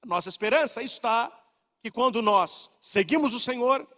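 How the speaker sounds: normal talking voice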